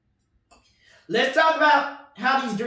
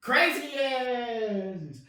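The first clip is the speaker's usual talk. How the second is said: normal talking voice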